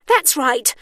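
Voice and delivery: high, loud impatient starlet; irritable. A narrator's reading. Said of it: "said the woman irritably".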